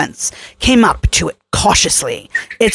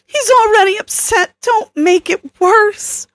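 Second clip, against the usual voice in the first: Kelly, worried; 13 semitones higher than usual. A narrator's reading. Kelly begging Troy not to provoke the jealous T-Rex, worried. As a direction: soften the plea, a touch shaky. Kelly voiced higher throughout.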